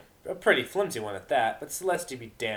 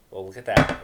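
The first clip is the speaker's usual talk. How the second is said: loud; 3 semitones lower, 10 dB louder than usual. Real, not acted. Reader aside, loud remark.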